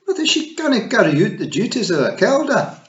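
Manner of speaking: high, loud protesting pixie daughter. Acted. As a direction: object hard to her mother. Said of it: "Fionn protested".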